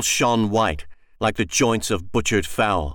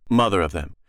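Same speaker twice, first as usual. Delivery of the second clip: ordinary delivery, nothing marked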